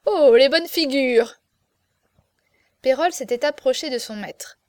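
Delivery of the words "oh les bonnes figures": loud, drawn-out gleeful exclamation; hilarious mockery. A narrator's reading. Friend echoing the marquis's joke, loud amused mockery. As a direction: echo the jeer, louder and more amused. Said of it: "Navaille riait aux larmes et répétait".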